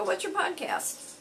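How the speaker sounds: high-pitched, curious question; retold eager curiosity. Bartender asking a customer about his podcast, eagerly curious. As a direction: pitch way up, keen to know.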